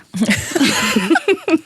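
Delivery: a quick, high delighted laugh; bright laugh. Real, not acted. Sharing a printout with her co-host, a delighted laugh.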